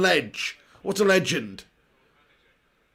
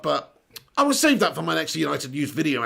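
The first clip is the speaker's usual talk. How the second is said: normal talking voice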